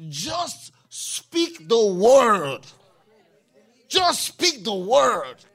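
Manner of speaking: slow, loud, drawn-out plea to Jesus; emphatic plea. Acted. The centurion begging Jesus from afar, slow loud plea. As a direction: lean hard on every word, slowly.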